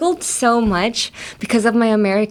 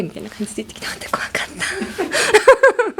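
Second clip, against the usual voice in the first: much higher, breathy giggling whisper; laughing whisper; 11 semitones higher, faster than usual. Real, not acted. Laughing about the scary kindergarten teacher.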